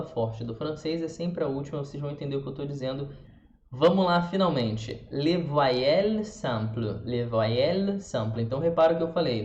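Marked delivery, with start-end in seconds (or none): 5.1-8.5 slow "Les voyelles simples" with silent final S; French pronunciation. Shown as a demonstration. Teacher modelling French sounds, slow careful pronunciation. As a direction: draw out "voyelles simples" as vwa-yel samp-l. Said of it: "Nenhum S foi falado no final".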